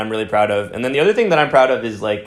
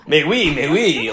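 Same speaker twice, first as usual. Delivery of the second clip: caricature French; 4 semitones higher, slower than usual. Retold or imitated. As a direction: ham up an over-the-top French waiter. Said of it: "just a caricature".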